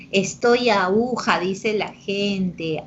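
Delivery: ordinary talk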